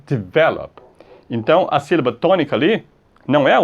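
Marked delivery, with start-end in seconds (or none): 0.0-0.8 develop said de-VEL-op; correct stress on middle syllable. Shown as a demonstration. Teacher giving the pronunciation, develop stressed on VEL. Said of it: "a pronúncia é develop".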